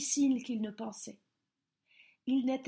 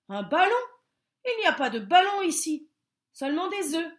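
louder, fast, scornful stork; stork retort; 6 semitones higher, 7 dB louder, faster than usual. A narrator's reading. Stork dismissing the bear, louder and fast. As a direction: dismiss him.

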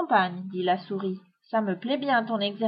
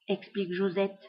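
plain reading voice

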